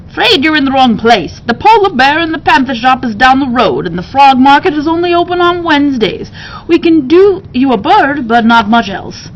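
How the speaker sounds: put-on a chatty shopkeeper letting the girl down; bird keeper voice.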